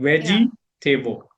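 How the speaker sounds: "veggie table" as two full words, VEJ-ee TAY-bul; old mispronunciation. Shown as a demonstration. Learner shows her old habit, two-word pronunciation. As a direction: split it into "veggie" and a whole "table". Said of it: "That's how I used to say".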